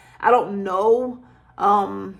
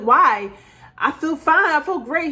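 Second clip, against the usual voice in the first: surprised protest; 6 semitones higher, 4 dB louder than usual. Retold or imitated. Protesting she feels fine.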